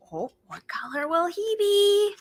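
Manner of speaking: high squeaky kitten voice; curious kitten. Acted. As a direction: wonder aloud like a tiny kitten.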